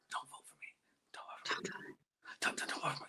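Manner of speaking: urgent hushed whisper; pleading stage whisper. Retold or imitated. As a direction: beg in a secret whisper. Retelling whispered plotting.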